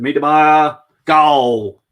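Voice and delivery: sudden loud goal shout; excited goal call. Real, not acted. Live goal call during match.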